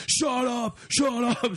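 high, strained mock yell; yelled order. Four big guys leaning over her, yelling high. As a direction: play a group yelling her down. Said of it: "four big motherfuckers all leaning over her going".

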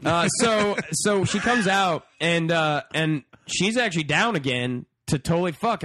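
ordinary talk

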